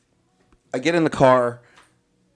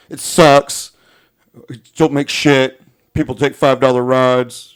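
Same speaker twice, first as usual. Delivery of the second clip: louder, grumbling driver voice; mocking griping driver; 9 dB louder, slower than usual. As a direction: whine it like a bitter loser. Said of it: "And he's like".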